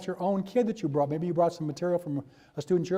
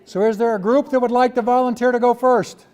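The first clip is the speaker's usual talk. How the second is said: loud, raised-pitch call over the room; 6 semitones higher, 11 dB louder than usual. Real, not acted. Teacher asks workshop groups for a volunteer, calling out. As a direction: project it to get every group's attention.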